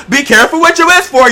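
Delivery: high, loud hammed-up proverb; mock sage warning. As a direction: deliver it like a cheeky fortune teller. Mock warning after tight-shirt joke.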